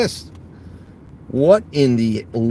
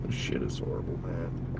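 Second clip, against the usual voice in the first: disgusted whisper; 13 dB quieter than usual. Real, not acted. Driver fed up with bad orders.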